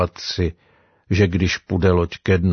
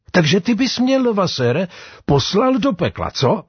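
much higher-pitched, accusing question; indignant challenge; 10 semitones higher than usual. Acted. Sailor challenges Levasseur over sending him to hell, indignant.

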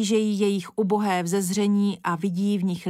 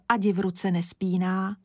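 plain reading voice

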